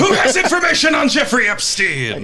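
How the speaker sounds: high shouted captain chant; bellowed parody chant; pirate captain. Pretend. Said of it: "parody of intro chant".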